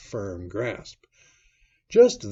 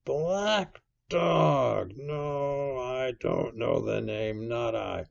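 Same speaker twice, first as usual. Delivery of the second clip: drawn-out, higher voice searching memory; wavering, pondering seaman's voice; musing Silver; 5 semitones higher, 4 dB louder, slower than usual. A narrator's reading. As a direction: think aloud, then seize on the recollection.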